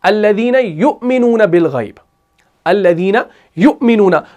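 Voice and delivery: measured recitation with a catch in "युमिनूना"; Quran recitation. Shown as a demonstration. Teacher reciting the third verse of Baqarah, measured recitation. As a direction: recite deliberately, stopping on the hamza after "यु". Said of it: "तीसरी आयत आप पढ़ते हैं".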